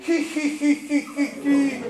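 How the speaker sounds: tight-lipped little hi hi giggle; prim giggle. Shown as a demonstration. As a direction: giggle primly with pursed lips. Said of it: "la bouche en cul de poule en faisant comme ceci".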